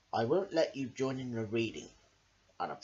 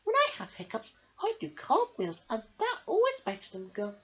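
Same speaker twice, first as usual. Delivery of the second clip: high, friendly sea creature voice; lightly voiced Starfish; 13 semitones higher than usual. A narrator's reading. Starfish sharing her cartwheel cure, high voice. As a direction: offer the tip cheerfully.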